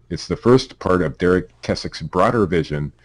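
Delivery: normal talking voice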